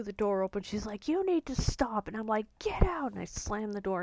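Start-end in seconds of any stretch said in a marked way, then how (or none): 1.1-2.1 imitating an upset mother, pitched high; stern order, retold. Retells mother's order mid-fight. 2.6-3.1 high-pitched mock scream of dismissal; retold scream. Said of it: "I was screaming".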